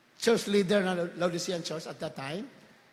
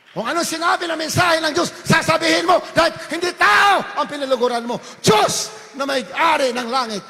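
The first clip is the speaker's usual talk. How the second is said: loud, high shouted sermon; fiery preaching; 10 semitones higher, 12 dB louder than usual. Acted. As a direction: preach it out at full force. Preaching at full force. (sumisigaw na pangangaral)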